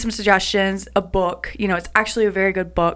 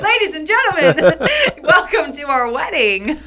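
laughing, high mock wedding announcement; hammed-up ceremony host through laughter; mock officiant; 6 semitones higher than usual. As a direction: announce the wedding like a preacher while giggling.

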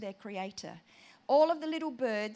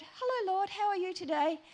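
very high bird-like singsong; little bird voice; 8 semitones higher than usual. Retold or imitated. Retelling her greeting to the Lord, little bird voice. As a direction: chirp the greeting right at the top. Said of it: "same voice".

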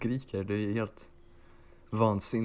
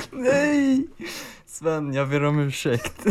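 mock sobbing; 7 semitones higher, 6 dB louder, slower than usual. Mock sobbing apology to Sven.

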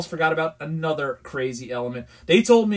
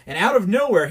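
usual speaking voice

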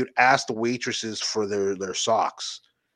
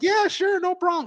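high, bright mock agreement; eager young waitress. Imitating young waitresses to friends, high eager agreement. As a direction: chirp it like an eager young girl. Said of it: "the younger ones are like".

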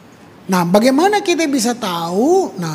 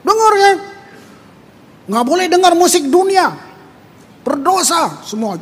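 imitated preacher; 7 semitones higher than usual. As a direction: lay down the law like a stern preacher. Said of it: "katanya hamba Tuhan yang naik". (menirukan pengkhotbah melarang, nada tinggi)

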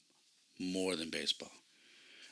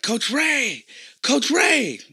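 high, loud happy shouting of a name; excited calling out; 14 dB louder, much slower than usual. Retold or imitated. Kid spotting his coach, yelling his name with joy. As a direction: call out across the field, thrilled to see him.

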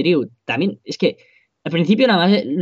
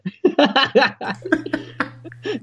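high burst of laughter; 12 semitones higher than usual. Real, not acted. Breaks character laughing.

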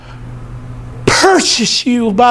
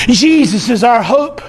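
ordinary talk

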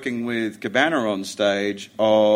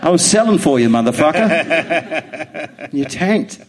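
louder, laughing, mock-indignant; laughing mock outrage; 5 semitones higher, 7 dB louder than usual. Real, not acted. Laughing mock scolding at co-host who tanked. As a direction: scold him through laughter.

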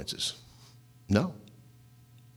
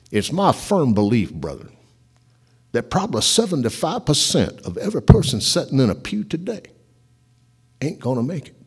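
Southern accent imitation; 3 semitones higher than usual. Preacher quoting a famous radio preacher, light Southern accent. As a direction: lightly imitate J. Vernon McGee, fond. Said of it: "I'm just imitating him 'cause I like him".

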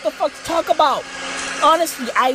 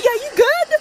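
high, wary; 11 semitones higher than usual. Acted. Suspicious of the driver.

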